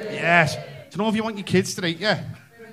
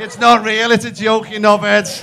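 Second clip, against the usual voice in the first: loud, raised-pitch jibe at the crowd; mock scolding; 4 semitones higher, 8 dB louder than usual. Comic tells off a divided audience, mock exasperated. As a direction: shout it at them in comic exasperation.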